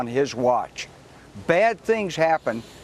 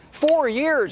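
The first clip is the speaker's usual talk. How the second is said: Four punched much higher; heated pundit debate; 9 semitones higher than usual. Real, not acted. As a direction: hit Four high and hard. Guest stresses the long inquiry.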